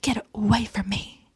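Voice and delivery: hushed, whispery mock plea; retold plea. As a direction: half-whisper a retold recoil, softly. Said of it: "and he's like".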